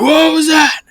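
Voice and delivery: high, loud tavern yell; drunken crowd shout. Acted. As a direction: bellow it like a rowdy drunk. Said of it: "drunken individuals".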